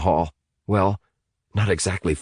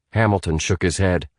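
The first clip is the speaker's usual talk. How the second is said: ordinary delivery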